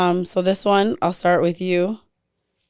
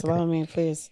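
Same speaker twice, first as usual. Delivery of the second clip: ordinary talk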